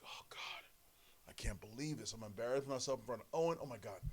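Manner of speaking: soft, breathy self-scolding; embarrassed whisper. Retold or imitated. Young wrestler whispering embarrassment in front of Owen. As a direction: mutter it under your breath in shame. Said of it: "I'm like".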